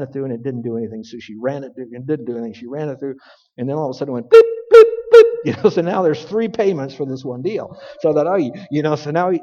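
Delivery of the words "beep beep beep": high, loud electronic beeps mimicked; machine beeping. Preacher mimics the self-checkout register beeping, high and loud. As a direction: imitate the checkout machine going off.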